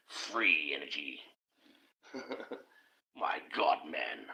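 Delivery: low, soft, slow chuckling "Free energy"; sneering chuckle at the idea; laughing mockery. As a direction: laugh it off under your breath. Laughing mockery after the outburst.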